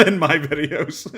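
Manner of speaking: slow words broken up by real laughter; laughing. Real, not acted. Commentator amused by cropping he would do, laughing. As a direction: talk through a laugh. Laughs while discussing thumbnail.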